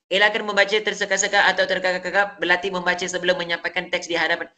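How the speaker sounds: fast monotone rattle through the text; wrong way of reading. Shown as a demonstration. Teacher showing pupils how not to read, rushed monotone. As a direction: read it all in one rushed, unvaried run. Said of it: "kamu tak boleh baca macam ni".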